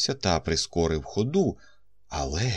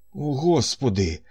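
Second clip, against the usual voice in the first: exclaiming; 6 semitones higher than usual. A narrator's reading. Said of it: "вигукнув Річард Гейдон".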